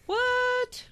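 high squeaky mock outrage; shocked. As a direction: play it like a total shock. Mock shock at theater plan.

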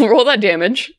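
normal talking voice

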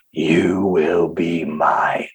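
very deep, drawn-out demon whisper; powerful demonic voice. Retold or imitated. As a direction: intone it slowly, far down low, like a demon. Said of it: "powerful, loud voice".

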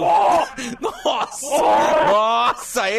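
loud, high surprised cry; shocked exclamation. Real, not acted. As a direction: gasp it out. Reacting to loud noise.

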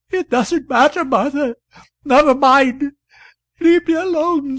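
high, loud sobbing cry, far above the narration. A narrator's reading. Wife pushing her friend away, sobbing loudly. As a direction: wail it out through sobs. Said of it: "sobbed the poor woman".

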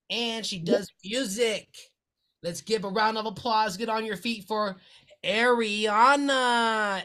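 slow, soft announcer build-up; announcer intro. Acted. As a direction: introduce her like a ring announcer.

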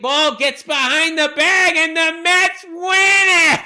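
mimicked announcer shout, high and drawn out; sportscaster's call. Re-enacting the Mets' winning call for his co-host, excited sportscaster shout. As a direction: build the imitation call to a long, ringing finish. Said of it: "that was the call that always got".

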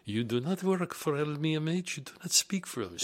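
mock scolding, held back in volume; retold screaming. French HR chief repeating her rebuke, toned-down mock scream. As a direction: imitate her tirade without really yelling. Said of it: "screaming at me".

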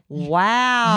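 loud, drawn-out wow; gasp, then belt out real astonishment; amazed wow. Real, not acted. Listener hearing how fast the number came up, loudly amazed. Listener reacts to the quick call.

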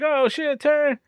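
high-pitched retold alarm; mock panic. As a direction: imitate a captain yelping a sudden order.

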